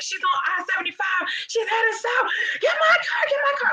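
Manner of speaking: high shouted panic, pleading; screaming caller. Retold or imitated.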